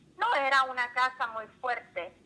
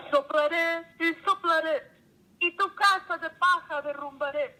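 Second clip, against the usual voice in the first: fierce wolf; 5 semitones higher than usual. A narrator's reading. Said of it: "El lobo feroz contestó".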